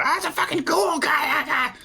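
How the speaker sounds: high-pitched, fast, hammed-up shriek; panicked scream. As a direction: scream it out in mock terror, way up high. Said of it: "will scream out".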